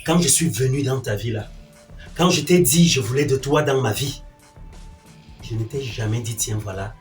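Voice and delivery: low, soft, controlled displeasure; quiet reproach. Acted. Man reproaching his partner about their life, low and restrained. Sternly recalling what he wanted.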